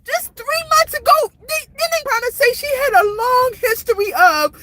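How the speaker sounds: high-pitched, tearful outpouring; real frustrated, tearful upset. Real, not acted. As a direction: plead your case through real tears. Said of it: "I'm so frustrated".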